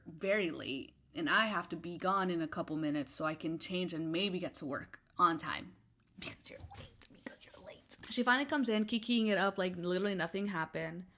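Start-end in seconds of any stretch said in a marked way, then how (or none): 6.2-8.0 soft, voiceless repeated whisper; hushed irritation, said twice; exasperated whisper. Real, not acted. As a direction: whisper the grievance under your breath. Whispered repeat of her grievance.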